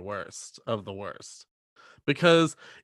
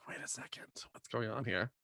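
whispered; 2 semitones lower, 16 dB quieter than usual. Retold or imitated. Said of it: "go like".

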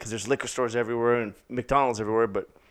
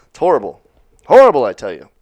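mock dramatic; 12 dB louder than usual.

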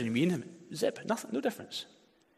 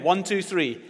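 ordinary delivery

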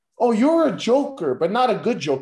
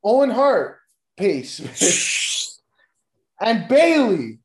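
usual speaking voice

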